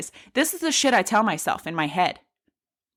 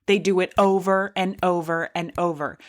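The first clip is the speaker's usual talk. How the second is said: normal talking voice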